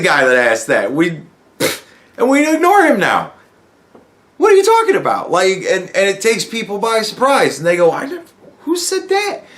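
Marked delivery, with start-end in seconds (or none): 4.4-5.3 very high, incredulous question; mock baffled. Conservatives reacting to an accusation, mock bewildered high. 7.9-9.4 high, softer, caught-off-guard protest; mock surprise. People taken by surprise by the claim, mock startled.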